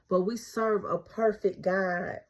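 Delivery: ordinary talk, nothing marked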